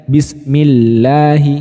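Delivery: bismillahi with a light, thin lam, very slow; slow tarqiq bismillahi; read thin. Shown as a demonstration. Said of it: "dengan suara yang rendah".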